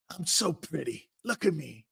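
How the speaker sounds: lightly voiced boasting champ, softly; hushed imitation of a preening boxer; Muhammad Ali. Champ walks in on football practice, lightly imitated soft boasting. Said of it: "there's fucking Muhammad Ali".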